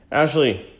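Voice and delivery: calling out to the next room; yelling across the room. Real, not acted. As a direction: call her name across the house. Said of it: "yell across the house".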